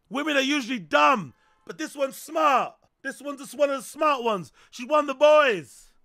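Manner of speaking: loud hype. Real, not acted. Hyped loud bro banter.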